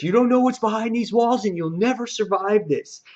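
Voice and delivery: high-pitched mock defiance; called-out warning. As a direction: imitate a guard calling down a warning. Acted guard's warning in retelling.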